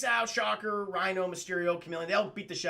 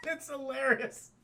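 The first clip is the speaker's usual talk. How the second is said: ordinary talk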